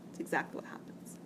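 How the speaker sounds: soft whispered aside, almost to herself. Real, not acted. Teacher to herself, confirming the law, whispered aside. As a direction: breathe it out as a quiet confirmation.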